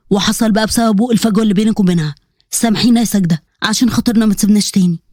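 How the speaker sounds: low-pitched father owning up; remorseful father. Acted. Father asking his daughter's forgiveness, remorseful.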